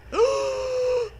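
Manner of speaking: sharp, very high mock gasp of shock; dramatic gasp. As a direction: gasp as if the twist floored you. Shocked reaction to vampire kids.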